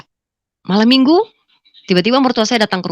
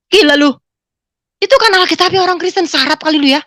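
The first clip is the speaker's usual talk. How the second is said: higher-pitched retold telling-off; indignant scolding; 7 semitones higher than usual. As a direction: act out mild outrage at the voice. Scolds the voice over Bible.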